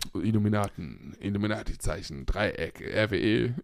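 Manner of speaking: low, hushed mysterious voice; spooky conspiracy voice. Pretend. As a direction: intone it like a secret cult. Mock conspiracy reveal.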